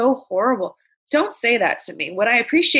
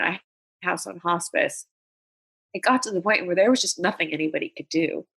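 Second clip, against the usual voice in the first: usual speaking voice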